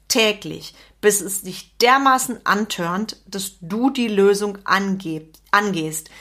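usual speaking voice

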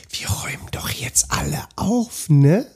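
leise, langsam gesäuselte Aufforderung; pädagogischer Tonfall. Acted. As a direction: behaucht und gedehnt zum Mitmachen überreden. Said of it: "Aber pädagogisch heißt". (sanft gedehnter Pädagogenton, mit nachgeschobenem Ne)

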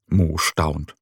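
plain reading voice

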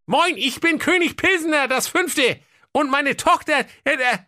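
very high, squeaky king voice; comic king voice. Acted. (hohe quäkige Königsstimme)

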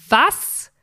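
mock astonishment; astonished what. Dentist's astonished outburst quoted.

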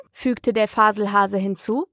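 usual speaking voice

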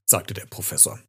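usual speaking voice

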